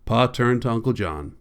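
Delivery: usual speaking voice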